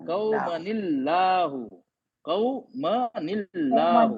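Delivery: कौमनिल्लाहु stretched out slowly; slow recitation demo. Shown as a demonstration. Teacher recites slowly to show the joining. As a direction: draw out कौमनिल्लाहु syllable by syllable. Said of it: "teacher demonstrates recitation".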